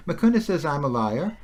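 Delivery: ordinary talk, nothing marked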